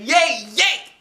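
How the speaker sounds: high, loud cheer. A narrator's reading. Girl hears no scissors are coming, cheering. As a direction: burst out with joy.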